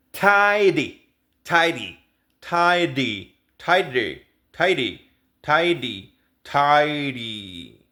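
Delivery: "tidy" as "tai-di". Shown as a demonstration. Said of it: "la di". ("tidy" con la T como una di suave)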